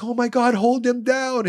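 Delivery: high, loud mock alarm; retold panicked crowd. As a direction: imitate frantic onlookers calling for help. Retells congregation restraining possessed person.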